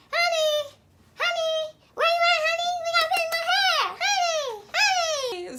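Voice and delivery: very high, loud squealed imitation; mock calling out. Client to stylist on break, high mocking call. Client calls out to her door.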